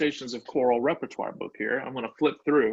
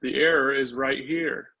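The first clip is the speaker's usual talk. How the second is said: usual speaking voice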